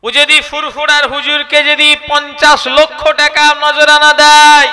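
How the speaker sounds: a slow, loud, high-pitched sermon burst; loud preaching. Real, not acted. Preacher scolding the congregation, loud and slow. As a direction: hammer the point home to the crowd.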